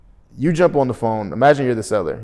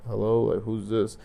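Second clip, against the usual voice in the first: upset, sick-sounding answer; 6 dB quieter than usual. Retold or imitated. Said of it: "they sound like they're upset or, or sick".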